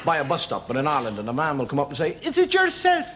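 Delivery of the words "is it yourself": much higher, louder mock greeting; high Irish greeting. A man at the bus stop greeting, high and cheery. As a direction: sing it up like a cheery local.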